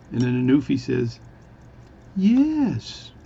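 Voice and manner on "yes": one very high, long-held word; really slow speech. Acted. Newfie answering the bartender, really slowly. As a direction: stretch it out high, then let it drop. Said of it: "is speak really slowly".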